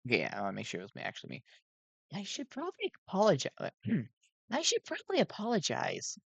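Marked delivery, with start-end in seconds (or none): 2.1-6.2 soft, high robot-like voice; gentle nervous animatronic voice; Sun voice, softly. A narrator's reading. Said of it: "he said softly".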